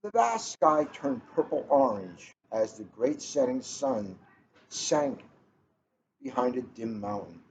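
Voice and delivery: gentle, even read-aloud pace; storybook read-aloud. A narrator's reading. Librarian reading a picture book to the room, storytelling voice.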